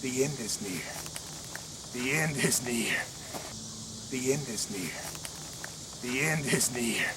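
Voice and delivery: low, soft, ominous whispering. Acted. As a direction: whisper a dark warning. Ominous villain vignette.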